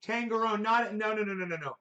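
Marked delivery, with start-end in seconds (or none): none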